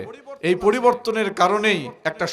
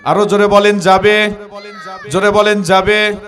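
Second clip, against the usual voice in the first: loud call for a louder answer; urging the crowd; 6 dB louder than usual. Real, not acted. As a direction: push the crowd to shout back.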